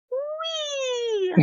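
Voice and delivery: high, soft, playful squeal of joy; gleeful wee. Retold or imitated. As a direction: squeal like a happy kid. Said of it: "my whole mood was just like".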